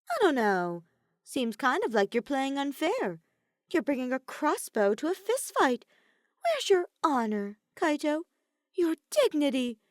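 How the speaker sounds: high, loud mocking taunt. A narrator's reading. Kokichi teases Kaito about honor, high and mocking. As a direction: needle him with fake reproach.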